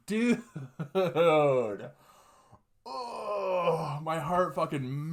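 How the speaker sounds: high, breaking laugh through the words. Real, not acted. Teller cracking up at the dog line, laughing.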